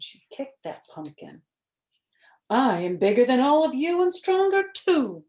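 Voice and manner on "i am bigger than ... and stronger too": higher, louder bragging mummy; lightly voiced mummy boast. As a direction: brag to all of them. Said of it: "boasted the mummy".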